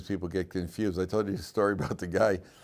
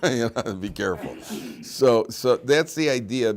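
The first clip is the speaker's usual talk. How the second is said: usual speaking voice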